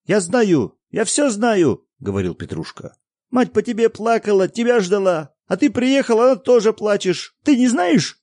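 put-on high, loud indignant boy; boy shouting. A narrator's reading. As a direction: shout at the father like a hurt child.

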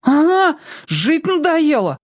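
high, slow scared protest; whiny little sibling. A narrator's reading. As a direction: whine back like a frightened kid. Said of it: "упирался Яшка".

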